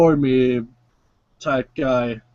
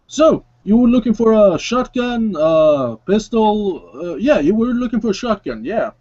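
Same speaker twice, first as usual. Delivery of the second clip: much higher, chatty salesman; Javier's sales pitch; 8 semitones higher than usual. Acted. Javier offers Mister Stitches a shotgun, higher chatty voice. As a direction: pitch the guns like a shopkeeper. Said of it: "Javier begins to take up guns".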